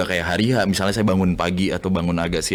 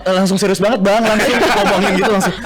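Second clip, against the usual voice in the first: laughing; 12 semitones higher, 5 dB louder than usual. Real, not acted. Laughing about host's reaction.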